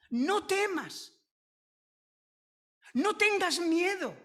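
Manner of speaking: high, forceful preaching; emphatic proclamation. Real, not acted. Preacher giving the congregation a daily command, emphatic proclamation.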